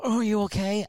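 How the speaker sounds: high-pitched imitation of a concerned wife; fussing wife. Imitates wife fussing over injury.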